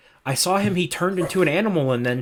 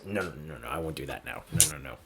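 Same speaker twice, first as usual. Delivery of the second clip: Monty's voice; 12 semitones lower, 13 dB quieter than usual. Acted. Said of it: "and then he goes".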